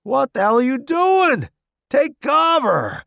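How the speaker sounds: high urgent yell, slowing on take cover; shouting. Acted. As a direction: bark the order over the bombs. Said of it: "an unfamiliar voice shouts".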